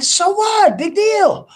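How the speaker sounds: very high, exasperated brush-off; dismissive. Retold or imitated. Telling his buddy it's no big deal, very high and dismissive. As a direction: wave it away, high and loud. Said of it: "And I'm like".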